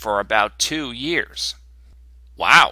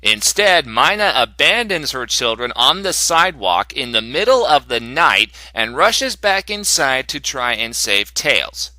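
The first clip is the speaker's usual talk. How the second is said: ordinary delivery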